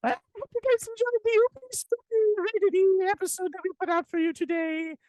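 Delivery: high squeaky leprechaun voice. Pretend. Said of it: "like a scary little leprechaun".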